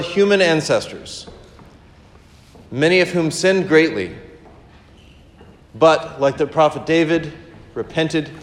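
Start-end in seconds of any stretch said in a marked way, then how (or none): none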